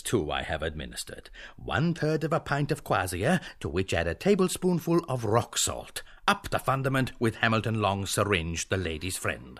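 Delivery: ordinary talk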